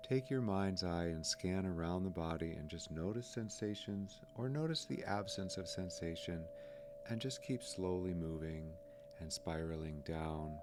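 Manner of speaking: calm body-scan voice, spaced by pauses; guided meditation. Acted. Guide leads listeners down the body, calm guided meditation. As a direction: lead them down phrase by phrase, unhurriedly.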